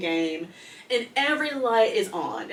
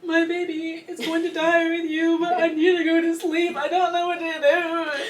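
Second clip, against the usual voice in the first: much higher, frantic and half-laughing; panicked; 9 semitones higher, 4 dB louder than usual. Retold or imitated. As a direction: act out new-mom panic. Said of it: "And I'm like".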